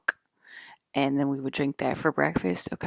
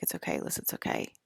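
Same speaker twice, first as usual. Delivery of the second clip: soft reassurance; 12 semitones lower, 5 dB quieter, faster than usual. Retold or imitated. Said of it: "he's like".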